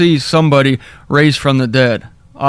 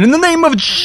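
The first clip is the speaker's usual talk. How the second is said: much higher, laughing mock preacher shout; mocking overwrought preacher cry; hammed-up faith healer; 13 semitones higher, much faster than usual. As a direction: ham up a sweaty name-it-claim-it preacher. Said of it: "That's why I said".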